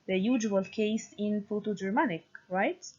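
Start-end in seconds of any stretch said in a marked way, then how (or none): none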